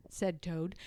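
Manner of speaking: ordinary delivery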